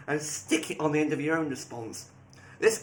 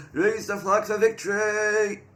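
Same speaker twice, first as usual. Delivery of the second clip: slow, loud, raised battle cry; rallying catchphrase; 5 semitones higher, 5 dB louder, slower than usual. Acted. Player quoting a show's predictable line, loud drawn-out catchphrase. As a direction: draw it out like a hero's rallying call.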